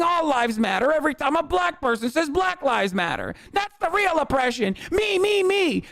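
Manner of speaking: put-on sneering take-off of a self-pitying kid, climbing in pitch to the end; mocking whiny teen gamer. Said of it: "That's what you fucking sound like".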